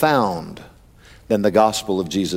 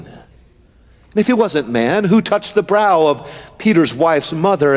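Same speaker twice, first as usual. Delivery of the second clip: ordinary talk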